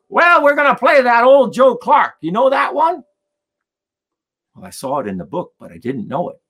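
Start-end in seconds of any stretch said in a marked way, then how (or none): 0.1-3.0 put-on loud, high-pitched old man; hollered old-timer voice, pitched way up. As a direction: imitate an eager old banjo player. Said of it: "And then he goes".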